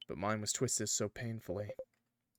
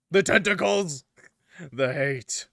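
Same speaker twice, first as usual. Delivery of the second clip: excited shout; 7 semitones higher, 11 dB louder, slower than usual. Real, not acted. Hyped at tentacle power.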